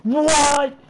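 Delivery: shocked shout. Real, not acted. Streamer shocked by a sudden in-game event, loud high yell. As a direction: yelp it as the game catches you off guard.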